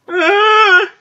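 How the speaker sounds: high, loud startled cry; frightened scream. Real, not acted. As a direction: shriek in fright. Sudden fright in game.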